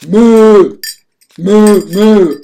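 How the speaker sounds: loud, high cow moo. Acted. Puppet cow interrupts the talk.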